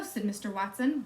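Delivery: plain reading voice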